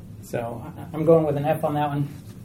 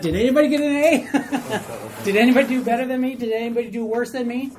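higher, laughing anxious student voice; a student comparing test scores, lightly mocked anxiety; 5 semitones higher than usual. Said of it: "You're like".

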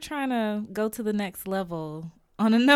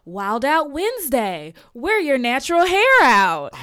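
loud, higher mock announcement; mock corporate announcement; 4 semitones higher, 10 dB louder than usual. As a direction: read it like a cheesy flyer. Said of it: "and it was like".

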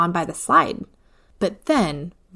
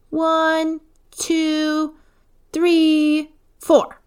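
high counting, getting louder; a proud little girl counting out loud; child counting proudly; 9 semitones higher, 3 dB louder, much slower than usual. A narrator's reading. Eva pinning her drawing, high proud counting.

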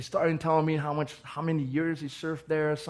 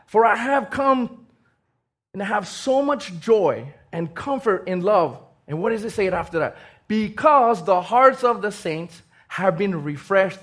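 loud, slowed preaching with heavy emphasis; fiery preaching; 4 semitones higher, 8 dB louder than usual. Acted. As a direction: proclaim the verse to the whole room. Preaching at full force.

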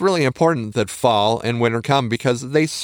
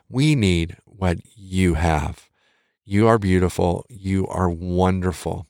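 slow, sincere and tender; slow, earnest reassurance to a doubting listener; heartfelt affirmation; 4 semitones lower, slower than usual. Real, not acted. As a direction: say it like you truly mean it to them. Speaker affirms listener directly.

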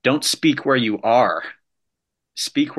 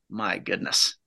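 ordinary talk

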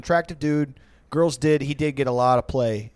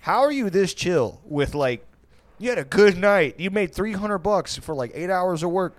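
incredulous; 7 semitones higher, 5 dB louder than usual. Retold or imitated. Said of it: "but I was like".

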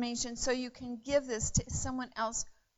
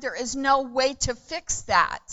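ordinary delivery

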